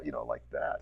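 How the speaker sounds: ordinary delivery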